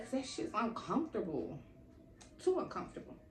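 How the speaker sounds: hushed, voiceless emphasis; whispered aside. Real, not acted.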